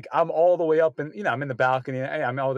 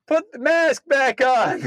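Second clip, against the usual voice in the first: loud, high, drawn-out theater yell; shouted from the seats, high and stretched; yelled heckle; 9 semitones higher, 5 dB louder, slower than usual. Retold or imitated. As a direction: holler it slowly at the stage. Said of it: "she just yelled out".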